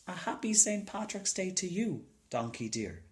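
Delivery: put-on a little boy politely greeting a donkey. A narrator's reading.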